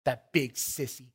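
put-on small, hushed little-boy voice; low and soft, lightly acting a shaken child; mock-timid mumble for a punchline; little shaky voice. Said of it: "in a little shaky voice".

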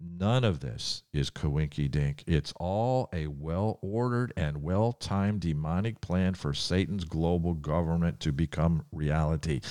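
ordinary talk

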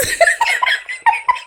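high-pitched delighted laugh; burst of laughter. Real, not acted. Host tickled by the accent she mimicked, high laugh. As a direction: crack up at your own impression. Laughs at her own impression.

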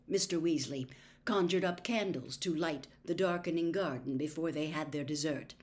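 ordinary delivery

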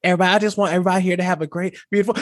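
fast bubbly chatter; friend's story gushing fast before the truck; rushed vlogger. Retold or imitated. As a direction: rattle it off cheerfully. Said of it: "she's like".

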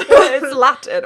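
giggling through the words; laughing while saying it. Real, not acted. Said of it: "I don't know how to say this".

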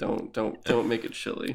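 half-whispered, soft and secretive; a conspiratorial aside, voiced low and breathy; hushed mock whisper. Screenwriters quietly agreeing to rename her, in a mock whisper.

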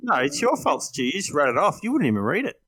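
ordinary talk, nothing marked

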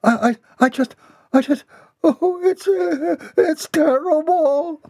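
very high, stammering wail; high-pitched crying, breaking down; tearful. A narrator's reading. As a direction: stammer through sobs, stretching the last word. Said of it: "burst into tears".

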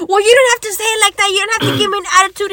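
imitated nagging, high and very fast. Virgo keeps dragging out the argument, nagging.

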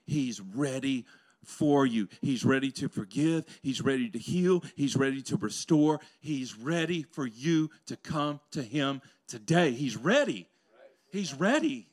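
loud, slow, building preacher's cry; preaching at full force. Acted. Preacher crying out to the congregation, loud and building.

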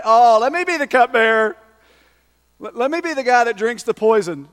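loud, hammed-up begging for the job; mock eager volunteer. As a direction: beg to be chosen, playing it for laughs.

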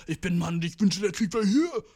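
joking tough-guy declaration; mock macho. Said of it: "das war ein Joke".

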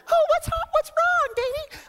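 very high, sweet fussing; mock concerned wife. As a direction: coo over him, high-pitched. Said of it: "This is how she responds".

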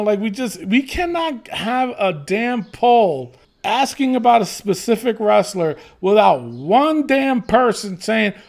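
usual speaking voice

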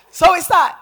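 high, very loud preacher's call; excited shout. Real, not acted. Preacher holding off the congregation's applause, excited shout.

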